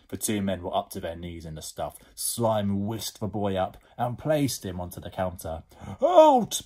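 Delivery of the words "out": very high, loud yelled order; bellow. Acted. Edmund orders the slime out, bellowing. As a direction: bellow it to drive the thing away. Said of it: "bellowed Edmund at the slime".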